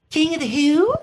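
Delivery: put-on high puzzled old woman; hammed-up old lady. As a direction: squeak it out like a confused crone. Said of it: "here comes the old lady".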